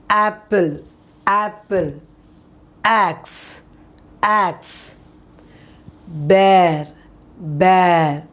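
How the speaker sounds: apple, axe, bear each said twice with open vowels; word drill. Shown as a demonstration. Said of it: "So this is".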